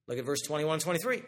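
usual speaking voice

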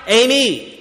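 usual speaking voice

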